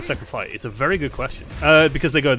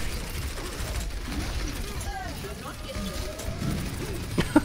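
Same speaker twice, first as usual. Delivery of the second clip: high, airy, gleeful cackle; high breathless cackle; 10 dB quieter than usual. Real, not acted. Caster cackling as the enemy gets trapped, high airy cackle.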